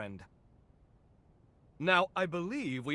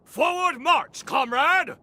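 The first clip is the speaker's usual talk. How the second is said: much higher and louder, slow drill-sergeant cry; mock marching order; 8 semitones higher, 7 dB louder, slower than usual. Scientist ordering her companion forward, mock military shout. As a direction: bellow it like a playful commander.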